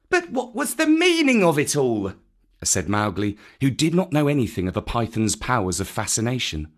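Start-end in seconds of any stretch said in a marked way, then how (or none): 0.1-2.1 high, piping question; young boy. Acted. Boy puzzled by the python's dance, high young voice. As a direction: ask in a puzzled boyish voice.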